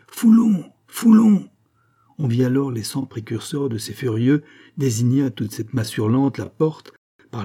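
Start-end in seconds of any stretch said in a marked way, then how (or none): 0.1-1.5 very high, loud mob cry; shouted. A narrator's reading. Thousands crying out on the square, shouting. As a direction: yell the name like a roaring crowd.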